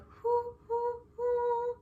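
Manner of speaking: high soft hoo hoo like a flute; flute hoots. Shown as a demonstration. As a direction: hoot high and gently like a flute. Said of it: "kind of like a soft and pretty horn sound".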